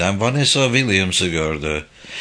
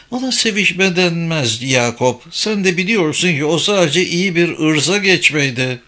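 higher-pitched character voice for a woman; Vanessa, cutting; 6 semitones higher than usual. Acted. Vanessa correcting McAdam about last night, in a raised voice pitch. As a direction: lift the pitch for her retort.

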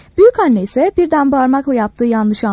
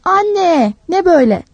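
high, drowsy call to mother; child calling out half asleep; sleepy shout; 6 semitones higher, slower than usual. A narrator's reading. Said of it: "uyku sersemi bağırdı". (uyku sersemi, yüksek sesle seslenme)

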